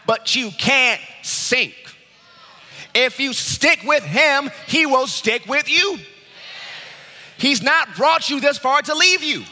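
loud, high pulpit climax; preaching at full force. Acted. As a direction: drive it home to the congregation.

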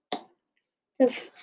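usual speaking voice

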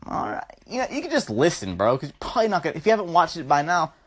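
ordinary delivery

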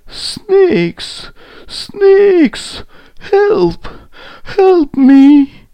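very high, loud cries; trapped lion yelling high; desperate call for help. A narrator's reading. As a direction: shout for rescue in short bursts. Trapped lion calls out to snakes.

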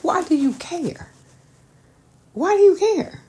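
pitched high, disbelieving why do you care; incredulous. Real, not acted. To a man trying to make his ex jealous, high and incredulous. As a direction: ask it like you can't believe it.

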